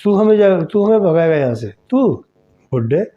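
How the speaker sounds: put-on loud, high furious snarl at the old man; angry threat. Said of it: "फिर गुस्से में कहने लगी". (गुस्से में ऊँची आवाज़ से धमकी)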